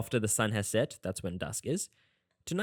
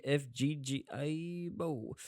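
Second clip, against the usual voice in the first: normal talking voice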